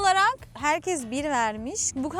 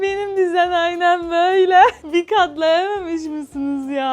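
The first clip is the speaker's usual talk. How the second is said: whiny outburst; 4 semitones higher, 10 dB louder than usual. Real, not acted. Disgusted at messy bed.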